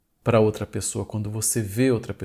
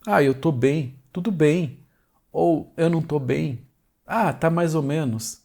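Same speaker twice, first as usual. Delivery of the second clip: awkward reply; 5 semitones higher, 3 dB louder than usual. Retold or imitated. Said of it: "você vai dizer".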